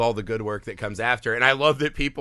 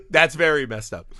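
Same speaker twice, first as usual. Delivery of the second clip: normal talking voice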